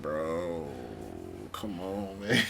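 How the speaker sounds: low, soft, weary appeal; muted plea. Acted. Traveler to a yelling seat neighbor, muted plea.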